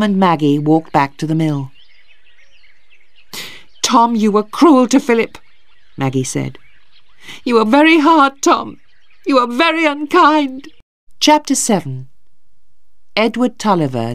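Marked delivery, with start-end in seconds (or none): 3.8-5.4 higher, upset reproach; reproachful. A narrator's reading. Maggie reproaches her brother for cruelty, upset. 7.4-10.7 high-pitched, indignant accusation; upset reproach. A narrator's reading. Maggie tells her brother off, upset.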